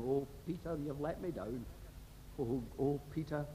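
a hushed lament of disappointment; sorrowful Christ. Acted. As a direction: Christ softly grieving over his disciple Peter. Christ's look at Peter.

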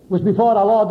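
ordinary talk

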